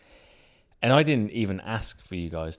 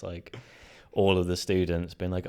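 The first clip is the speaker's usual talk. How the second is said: ordinary delivery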